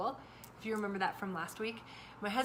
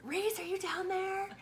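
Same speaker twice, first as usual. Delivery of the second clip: much higher slow call down the stairs; yelling down; 10 semitones higher, slower than usual. Retold or imitated. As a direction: call down to the basement. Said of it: "I yell down to the basement".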